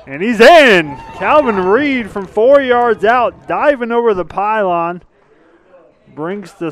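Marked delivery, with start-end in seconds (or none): 0.1-5.0 loud excited play-by-play; touchdown call. Real, not acted. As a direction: shout the score as it happens. Scoring dive call.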